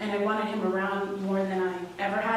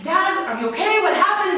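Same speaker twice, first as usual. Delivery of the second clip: high, loud anxious plea to her father; worried cry; 9 semitones higher, 7 dB louder than usual. Retold or imitated. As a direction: call out in panic, needing to know he's alive. Panicked call to injured dad.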